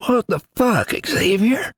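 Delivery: high, groggy half-awake question; just woken and confused; sleepy mumble. A narrator's reading. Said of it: "Eli mumbled".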